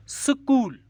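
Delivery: School high and loud, split as su-kool; syllable break. Shown as a demonstration. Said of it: "यहाँ पे हमारी आवाज़ टूटी". (School को सु-कूल तोड़कर, ज़ोर से)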